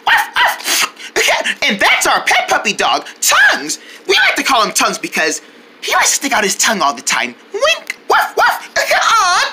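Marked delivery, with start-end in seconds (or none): 0.1-1.0 high mock puppy woofs; girl barking as the pet puppy. Playing the puppy Tongues. 7.5-8.7 puppy bark. Pretend. Puppy sounds again.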